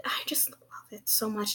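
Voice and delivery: breathy adoring whisper. Real, not acted. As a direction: whisper it lovingly. Adoring a crystal.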